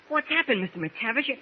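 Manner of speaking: put-on a boy's shocked questions; alarmed surprise. Boy finds sailor tied up.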